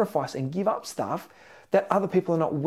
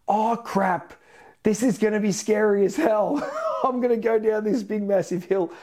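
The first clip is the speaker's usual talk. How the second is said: higher, half-laughing mock dread; a jokey oh-no moment before the drop; mock panic; 6 semitones higher than usual. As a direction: play the rider's sudden fright at the top. Imagined roller coaster panic thought.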